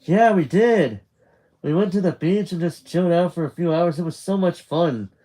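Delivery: high, quick, eager chatter; excited recounting. Acted. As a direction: gush to your mum about the day. Nick excitedly tells mum about day.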